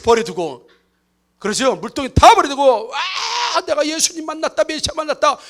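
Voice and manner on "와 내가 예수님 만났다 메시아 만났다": higher, excited proclaiming; lightly voiced exultant announcement; joyful shout. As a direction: cry it out in delight. Woman shouting she met Messiah.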